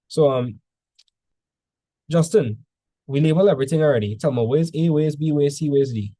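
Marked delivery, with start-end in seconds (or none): none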